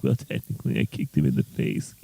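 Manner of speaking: low, hushed mock retort; Daniel. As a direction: drop low and soft for a smug correction. Acted retort of Daniel in trailer.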